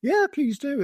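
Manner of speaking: high, eager yes from a friendly local; mock-cheerful villager saying yes. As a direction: chirp it up like a welcoming host. Villager again welcomes the soldiers.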